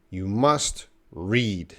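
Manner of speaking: "must ... read" slowly, word by word; slow correction. Shown as a demonstration. Teacher corrects the learner's grammar, slow and deliberate. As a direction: say "You must read" slowly, pausing before "read". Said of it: "No to".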